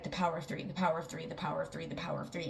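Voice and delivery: hushed inward mantra, retold; silent repeating in head. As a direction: murmur it like a looping thought. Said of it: "constantly repeating it in my head".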